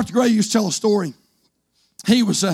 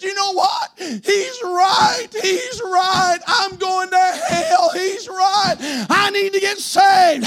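high, crying-out preacher's retelling; acted anguished conviction; 7 semitones higher, much slower than usual. Tearful girlfriend to her boyfriend, imitated anguished cry of conviction. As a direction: cry it out with long, stretched words.

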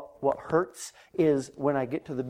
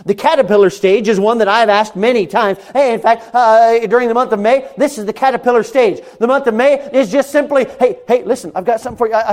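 high, loud fervent sermon; full-force preaching; 8 semitones higher, 11 dB louder than usual. Real, not acted. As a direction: preach it out to the back row.

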